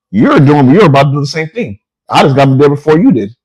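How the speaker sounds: retold outraged self-defence; indignant protest. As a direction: act out arguing back at a cop. Acted protest to the cop.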